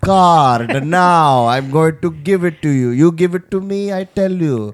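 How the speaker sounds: hammed-up Indian phone agent; Indian accent. Comic plays a debit card call center agent, mock Indian accent. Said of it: "use that accent".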